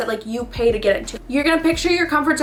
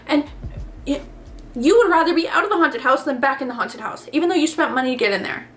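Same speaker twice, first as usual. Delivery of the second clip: ordinary delivery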